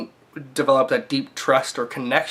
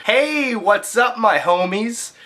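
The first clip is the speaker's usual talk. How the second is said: much higher, bright YouTuber hype; hyped greeting; 8 semitones higher, slower than usual. Acted. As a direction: greet fans with exaggerated energy. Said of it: "I never talk like this outside of videos".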